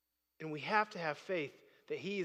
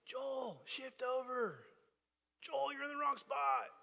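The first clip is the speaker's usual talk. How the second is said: high, held-back sideline call; football coach calling; 7 semitones higher, 3 dB quieter, slower than usual. Retold or imitated. Coach correcting a kid on the field, imitated call. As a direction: imitate a distant coach's shout, softened. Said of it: "You hear that coach's voice".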